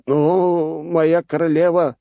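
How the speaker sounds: high, slow hesitant protest; objecting advisor. Acted. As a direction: try to object carefully. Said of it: "попытался возразить второй советник".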